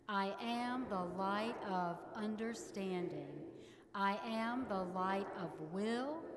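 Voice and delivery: affirmation like you mean it. Shown as a demonstration. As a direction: proclaim each light with conviction. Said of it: "say them like you mean it".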